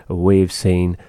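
normal talking voice

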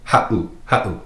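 "ha'u" with a catch in the throat; "ha'u" as ha, sudden stop, then oo; glottal stop. Shown as a demonstration. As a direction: break "ha'u" in two with a tiny pause. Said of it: "that pause".

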